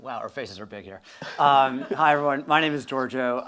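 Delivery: normal talking voice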